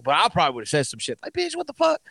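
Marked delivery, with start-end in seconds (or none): 1.3-2.0 high mock outburst. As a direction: throw a hammed-up retort. Imagined retort.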